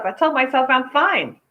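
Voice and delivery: usual speaking voice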